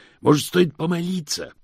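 loud, high-pitched plea; desperate exclamation. Acted. Hubert begging Moist to try prayer, desperate. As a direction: cry it out as a last hope. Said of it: "воскликнул Хьюберт в отчаянии".

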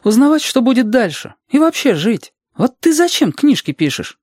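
put-on high, keen child voice; curious Reshka. Reshka challenging Alexander about his writing, high child voice.

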